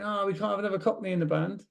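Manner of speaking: quick, high mocking quote of the band; brisk, lightly mocking quote; mock band member. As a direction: toss it off like a cheeky bandmate. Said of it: "That's why you're saying".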